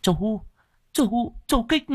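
very high, halting call; distressed woman's high-pitched protest; desperate appeal. Acted. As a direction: plead with your brother-in-law. Wife appeals to brother-in-law.

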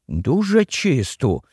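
ordinary delivery

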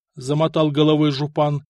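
plain reading voice

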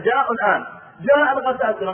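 panicked warning; alarmed. Retold or imitated. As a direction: announce the enemy has come. Alarmed news of the Ghassanids.

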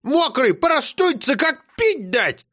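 put-on high, fussing old woman; grandma panicking he'll catch cold; alarmed grandma. A narrator's reading.

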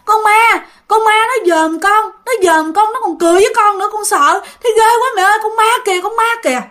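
put-on a small child wailing in fear of the ghost; crying in terror. Child clings to mother at night, crying in terror. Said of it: "nó mới vừa khóc mà vừa nói".

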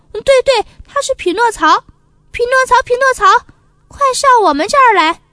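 excited. Acted. Puppet excitedly calls Pinocchio up.

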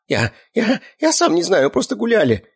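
high, stammering captive; weak, panicky protest; frightened babble. A narrator's reading. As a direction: stammer out an excuse in a high, scared voice. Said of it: "испуганно пролепетал пленник".

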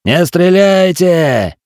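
high, slow yell; shouting. A narrator's reading. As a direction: yell and wave your arms. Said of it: "орал я".

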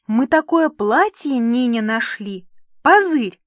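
gush, then burst out on "Позырь"; excited child. A narrator's reading. Jumping girl shows off Nina's dress, excitedly.

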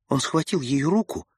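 ordinary delivery

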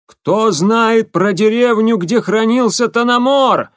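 high, slow yell over loud machines; shouting over machinery. Acted. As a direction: bellow it over the clatter. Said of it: "С трудом перекрикивая шум машин".

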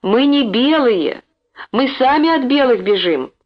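mild pleading shout; shouting to be heard. A narrator's reading. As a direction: make a deaf man hear. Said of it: "закричала ему на ухо".

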